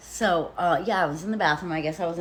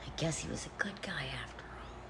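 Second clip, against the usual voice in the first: whispered bitter irony; quiet bitter aside; 13 dB quieter than usual. Real, not acted. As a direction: whisper it under your breath, barely there. Bitter aside ending the account.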